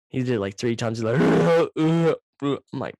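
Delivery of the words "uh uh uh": loud, high gagging heaves; retching heaves. Retold or imitated. Imitating his friend throwing up, loud high retching heaves. Friend vomiting three times.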